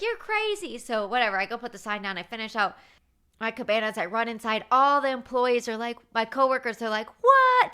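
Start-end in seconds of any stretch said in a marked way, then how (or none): 0.0-0.8 amused boss. Retold or imitated. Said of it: "he's laughing so hard". 7.2-7.7 high, loud shocked "What?"; stunned coworkers. Retold or imitated.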